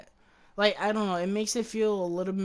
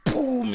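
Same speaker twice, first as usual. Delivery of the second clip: mock gunshot; 6 semitones higher, 8 dB louder than usual. Imitating the gunshot.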